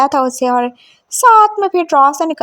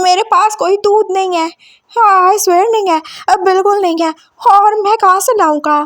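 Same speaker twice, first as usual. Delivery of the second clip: higher-pitched, mildly alarmed protest; panicked denial; 4 semitones higher, 5 dB louder than usual. A narrator's reading. Frightened at being asked for milk, panicked denial. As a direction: deny it in a rising fluster. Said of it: "वो जैसे डर ही गया था".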